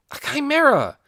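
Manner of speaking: high, loud eureka; sudden realization. Acted. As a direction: it just clicks, blurt it out.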